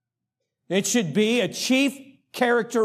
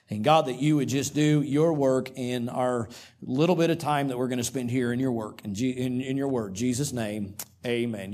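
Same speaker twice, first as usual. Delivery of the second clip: quiet, lower prayer voice; 7 semitones lower, 3 dB quieter, faster than usual. Acted. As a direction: pray softly before the congregation. Pastor leads opening prayer.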